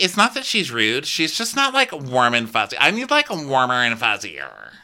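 high, swooping petulant complaint; mock whining. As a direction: imitate a needy guest whining. Acted whining guest complaint.

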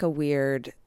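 normal talking voice

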